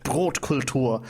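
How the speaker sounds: slow, savoured German word; deliberate German enunciation; relished German pronunciation. Shown as a demonstration. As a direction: draw it out in a German accent. Repeats the strong German word.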